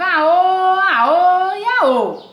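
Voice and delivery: loud, high, slow drawn-out yells; repeated shout. Acted. Teacher acting the mole yelling on and on. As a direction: stretch each cry out long. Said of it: "acting out the yelling".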